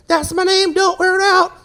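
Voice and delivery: high mock-cocky kid voice; kid's catchphrase. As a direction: sing out the playground comeback. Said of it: "She calls his name and goes".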